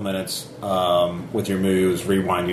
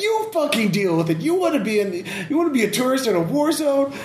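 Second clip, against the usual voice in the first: much higher, scornful voice; angry sniper. Acted. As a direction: snarl at the intruder.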